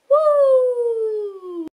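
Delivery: high cheering whoop; excited whoop. Real, not acted. Vlogger cheering to viewers about finishing the book, excited whoop.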